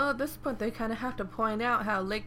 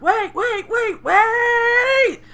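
much higher, louder desperate yell; shouting wait; 14 semitones higher, 12 dB louder, much slower than usual. Retold or imitated. Swordsman chasing a thief, yelling loud and high. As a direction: chase someone down, calling after them.

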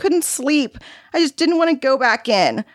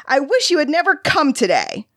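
screamed back, understated; 10 semitones higher than usual. A narrator's reading. Boy rejecting his visitor, mock screaming. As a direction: lash out like an upset kid, voice held back. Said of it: "He screamed back at her".

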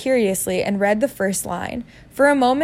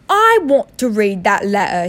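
louder, insistent son; loud demand; 6 dB louder than usual. Acted. Ignored son demands to see the letter, loudly. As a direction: raise your voice and insist. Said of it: "he said loudly".

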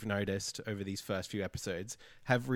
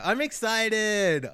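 mock excited; 9 semitones higher, 6 dB louder, much slower than usual. Mock enthusiasm.

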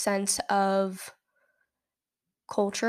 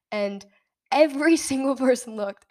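ordinary delivery

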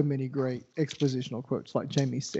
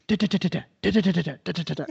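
rapid soft "da da da" gun bursts, fading out; imitated gunfire; 6 dB quieter, much faster than usual. Podcaster mimicking Arthur's gunfire in the fight scene, rapid soft bursts. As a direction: spit the syllables out like a machine gun.

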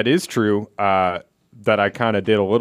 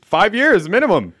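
high, loud disbelief; can you believe it; incredulous; 12 semitones higher, 7 dB louder than usual. Retold or imitated. Quoting dad's tough line.

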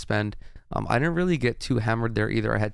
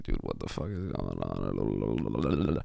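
much lower, half-asleep mumble; groggy; 14 semitones lower, 6 dB quieter, much slower than usual. Retold or imitated. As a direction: sound barely awake and dazed. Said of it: "I'm like".

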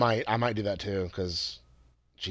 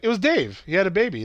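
higher, louder rushed plea; cramming the message; 7 semitones higher, 4 dB louder than usual. Retold or imitated. A son crams baby news into a collect call name slot, much higher and louder. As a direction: squeeze the news into the recording slot. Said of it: "And he's like".

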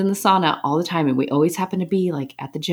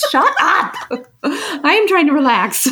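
mock exasperation; 13 semitones higher, 5 dB louder, slower than usual. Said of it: "I'm like".

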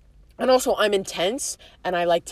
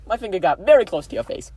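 ordinary talk

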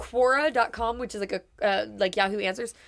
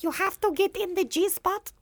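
higher European-accent impression; 9 semitones higher than usual. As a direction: do Doctor Ruth's European accent. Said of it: "she's, like, European".

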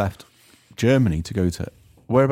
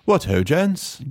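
put-on posh English accent; 6 semitones higher, 4 dB louder, much slower than usual. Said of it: "He's like".

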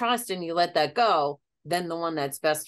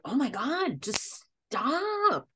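drawn-out, high 'stop'; exasperated plea to oneself, voice rising on stop; fed up with your own overthinking; 6 semitones higher, 3 dB quieter, much slower than usual. Retold or imitated.

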